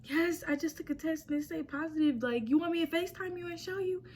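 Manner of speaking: high-pitched replay of an upset phone call. Retold or imitated. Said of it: "I'm not saying it like this. I'm on the phone like".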